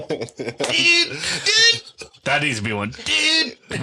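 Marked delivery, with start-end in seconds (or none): 0.0-1.8 very high, held squeak; squeaky dude bit. Pretend. Caught himself saying it, high squeaky mock dude. 2.9-3.6 very high, held squeak; squeaky dude bit. Pretend. Caught himself saying it, high squeaky mock dude.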